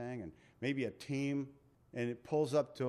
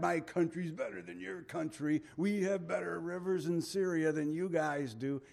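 mock lashing out; 4 semitones higher than usual. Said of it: "he's lashing out now. He's mad".

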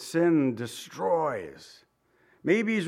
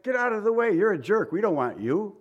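higher-pitched, acted brush-off; mock dismissive rejection; 6 semitones higher than usual. Preacher voicing religious leaders rejecting the Messiah, mock dismissive. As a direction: imitate a scornful rebuff, lightly hammed-up.

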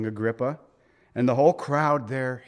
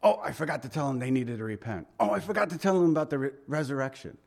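hurried self-reminder; 4 dB quieter, faster than usual. Retold or imitated.

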